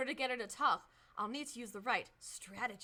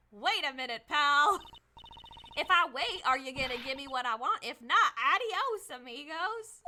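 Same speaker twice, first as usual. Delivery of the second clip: brash Southern twang, much louder; loud Southern country accent; 6 dB louder than usual. Acted. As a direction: call out boldly in a country accent. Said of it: "I'm definitely giving her a country accent".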